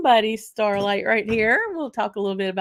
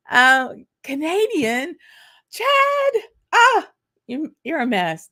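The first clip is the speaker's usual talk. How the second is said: much higher, louder, drawn-out name call; playful excited call-out; 8 semitones higher, 5 dB louder, slower than usual. Real, not acted. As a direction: tease a friend with a squealing hello.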